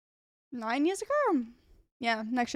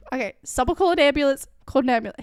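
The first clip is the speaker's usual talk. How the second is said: louder, urgent call for help; retold panic; 7 dB louder, faster than usual. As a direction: re-enact calling out in a hurry.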